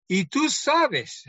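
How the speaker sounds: high, slow, teasing "y tú sabes"; daring challenge. Retold or imitated. Young preacher daring the older pastor, slow and high. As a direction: draw it out like a knowing dare.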